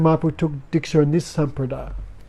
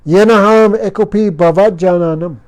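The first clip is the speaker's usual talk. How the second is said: verse recitation; 3 semitones higher, 5 dB louder than usual. Shown as a demonstration. Reciting Sanskrit verse to audience.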